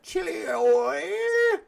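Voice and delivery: high, drawn-out squeaky voice of the chili oil guy; high slow mock chili oil guy. Mimicking the New Zealand pepper eater to chat, high and slow. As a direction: stretch it out high and slow like him. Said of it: "And he talks like that".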